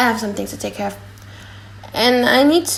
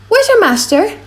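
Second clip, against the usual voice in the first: eager call; 11 semitones higher, 4 dB louder than usual. Acted. Gratiano calls out for master.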